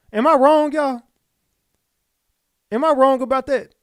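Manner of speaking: high, loud disbelieving call to viewers; loud appeal. Real, not acted. Host asking his viewers, loud and disbelieving.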